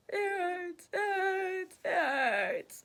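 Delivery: high, strained tearful whimper; imitating a pained sob; mock crying. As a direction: whimper it like you're in agony. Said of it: "you're literally crying".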